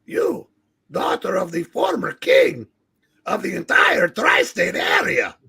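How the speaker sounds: pompous villain. Acted. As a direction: declare it like an arrogant warlord. Reads villain Tarala's lines.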